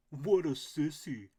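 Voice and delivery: loud, slow bully duck voice; bully squawk. A narrator's reading. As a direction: block the path and sneer. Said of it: "Drake howled".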